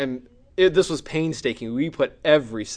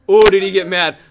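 ordinary talk

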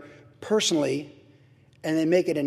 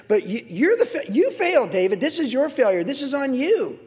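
accusing preacher; 6 semitones higher than usual. Acted. Preacher confronting David.

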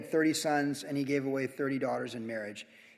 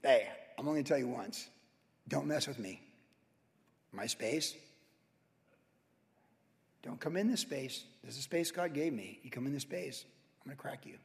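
quiet warning; 2 semitones lower, 6 dB quieter than usual. Retold or imitated.

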